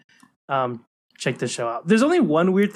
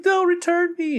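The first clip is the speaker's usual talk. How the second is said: put-on high, whiny little-girl voice; tearful Anya; 14 semitones higher than usual. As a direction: whimper it like a scared kid. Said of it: "And he's like".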